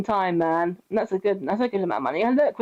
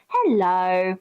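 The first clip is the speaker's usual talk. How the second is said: tag; 3 semitones lower than usual. Retold or imitated. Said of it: "Glinda's just like".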